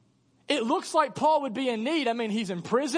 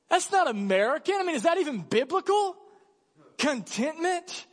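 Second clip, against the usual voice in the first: higher, scandalized mock disbelief; mock outrage; 6 semitones higher than usual. Preacher parodying skeptics of contentment, mock scandalized. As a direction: scoff at the idea in mock horror.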